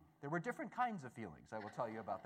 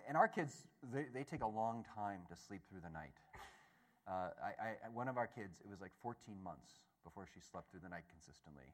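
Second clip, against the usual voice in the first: usual speaking voice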